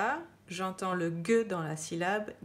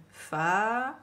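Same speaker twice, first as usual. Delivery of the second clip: "Fa-ti-gué" détaché, chaque syllabe; sépare bien fa, ti, gué; syllabe par syllabe; 2 semitones higher, 5 dB louder, slower than usual. Shown as a demonstration. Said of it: "on écrit syllabe par syllabe". (découpage syllabique)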